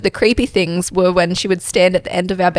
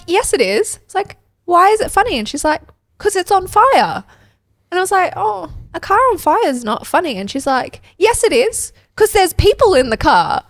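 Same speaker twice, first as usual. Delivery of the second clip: excited retelling; 12 semitones higher than usual. Retold or imitated. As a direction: get higher and more worked up as the story goes. Retold joke argument with daughter.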